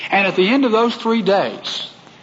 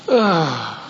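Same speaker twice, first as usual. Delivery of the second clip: long falling groan of dismay. Retold or imitated. Preacher re-enacting his shock at the news, dismayed groan. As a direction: let out a groan sliding down.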